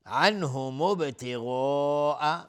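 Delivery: ابتغاء with a long stretched "aa" before the catch; obligatory madd. Shown as a demonstration. Teacher showing connected madd, drawn-out recitation. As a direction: hold the last long vowel of ابتغاء, then stop it. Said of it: "مد واجب متصل هست".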